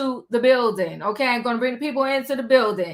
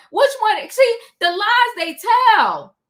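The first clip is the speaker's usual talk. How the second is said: high, slow and loud disbelief; calling out the lies to the viewers; incredulous call-out; 8 semitones higher, 5 dB louder, slower than usual. Real, not acted. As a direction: drag the words out in disbelief. Mocking a castmate's lies.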